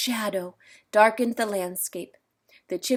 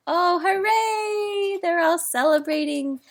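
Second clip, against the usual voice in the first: excited cheer; 8 semitones higher than usual. Real, not acted. Reader celebrates.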